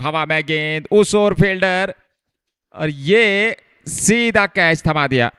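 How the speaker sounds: high, fast-rising wicket call; excited cricket commentary. Real, not acted. Catch taken, wicket falls. (उत्साहित cricket commentary)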